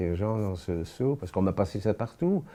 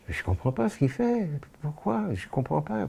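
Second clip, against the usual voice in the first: bewildered disbelief; 8 semitones higher than usual. Retold or imitated. Survivor reliving the tattooing, bewildered and much higher. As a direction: voice jumps much higher in disbelief, then settles.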